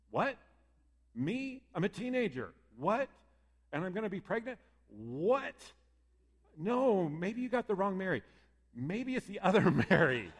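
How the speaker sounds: voicing a startled teenage girl who can't believe it; incredulous. Mary protesting to the angel, high and incredulous. Imagined Mary's incredulous reply.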